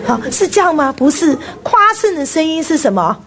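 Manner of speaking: ordinary talk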